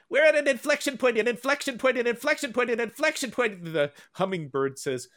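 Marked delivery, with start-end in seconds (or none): 0.1-3.6 high, repetitive bird chirp of words; cartoon bird. Host reading a cartoon bird's line to listeners, high repetitive bird voice. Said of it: "this bird on the left says".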